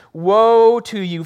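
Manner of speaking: loud, slow, drawn-out woe; proclaiming woe. Acted. Pastor voicing Jesus rebuking the Pharisees, loud and slow. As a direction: call down judgement on them.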